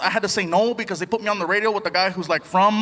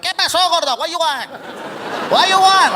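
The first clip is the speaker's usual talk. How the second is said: higher, pushy Mexican vendor voice; rude taco guy; 8 semitones higher than usual. Retold or imitated. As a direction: bark the order question like a grumpy vendor. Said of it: "the guy was like".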